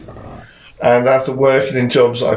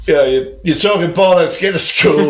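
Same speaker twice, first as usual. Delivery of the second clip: gruff dad; 6 semitones higher than usual. Retold or imitated. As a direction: brush off a kid, dad-style. Dad voiced in story.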